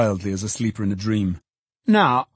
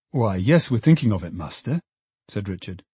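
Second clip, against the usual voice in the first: ordinary delivery, nothing marked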